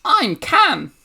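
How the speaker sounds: high, loud goblin squeal; piercing, excited creature voice. Acted. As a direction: announce the name as an eager little goblin. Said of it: "a goblin comes, like, running up and goes".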